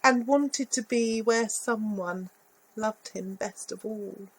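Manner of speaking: ordinary delivery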